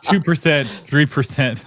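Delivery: laughing through the words. Real, not acted. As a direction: giggle as you say it. Laughing at small increase.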